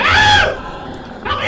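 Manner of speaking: very high loud scream for help; terrified scream. Real, not acted. Player dragged away by the monster, screaming for help. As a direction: shriek as you are dragged off.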